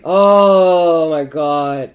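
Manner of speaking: slow, drawn-out groan of exasperation; exasperated drawn-out oh my God. Real, not acted. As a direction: stretch it out, fed up.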